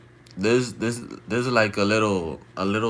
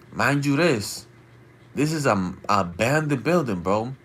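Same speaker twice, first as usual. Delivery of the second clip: ordinary talk, nothing marked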